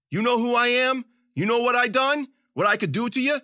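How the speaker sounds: high, loud, outraged boasting; angry threat. A narrator's reading. Captive threatening Zoot from his knees, angry. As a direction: threaten him at a high pitch.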